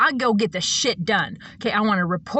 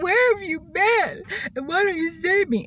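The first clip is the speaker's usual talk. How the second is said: mock pleading; 8 semitones higher than usual. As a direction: imitate a helpless victim begging for rescue. Voicing a needy person's plea.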